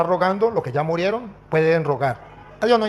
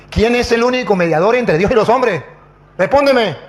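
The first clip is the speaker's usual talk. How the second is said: raised, louder, pressing questioning; re-enacted forceful challenge; insistent demand; 4 semitones higher, 5 dB louder than usual. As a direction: press a silent opponent for an answer. Demands answer from silent pastor.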